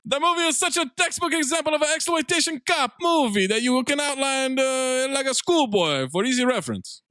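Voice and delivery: high-pitched cartoon Italian; squeaky mock Italian accent; put-on Italian accent. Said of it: "You gotta do that accent too".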